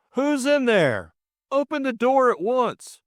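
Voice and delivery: high, insistent angry demand. A narrator's reading. George demanding entry through the locked door, furiously. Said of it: "furiously upraised, came loud and distinct".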